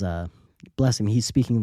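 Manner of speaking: usual speaking voice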